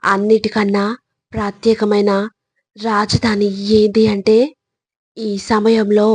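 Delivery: ordinary delivery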